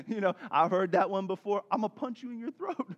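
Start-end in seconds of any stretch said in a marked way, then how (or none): 1.7-2.9 put-on quick, higher, softer kid's threat; mock threat. One daughter to another over stolen clothes, mock threat. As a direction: rattle off a playful threat. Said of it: "a threat".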